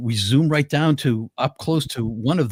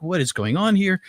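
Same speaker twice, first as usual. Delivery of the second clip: higher-pitched baffled question; retold bewilderment; 7 semitones higher than usual. Revived climber who doesn't know who he is, mock bewilderment. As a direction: act out waking with no idea.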